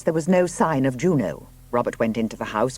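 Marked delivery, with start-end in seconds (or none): none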